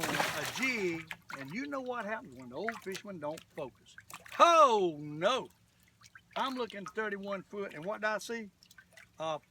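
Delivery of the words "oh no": sudden loud dismayed cry. Real, not acted. Fisherman to himself as the fish gets off, loud dismay. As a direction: blurt it out as things go wrong. Line snag while fishing.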